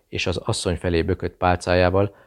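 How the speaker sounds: ordinary delivery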